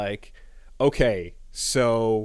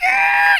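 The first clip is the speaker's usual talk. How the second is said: sudden piercing shriek, much higher and louder; mock scream; 5 dB louder than usual. Azula losing it at her brother, piercing mock scream. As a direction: let out a shrill cartoonish scream. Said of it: "And then she's like".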